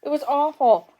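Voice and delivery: put-on high, loud childish wail. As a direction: wail it like a scared little girl. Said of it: "she wailed again".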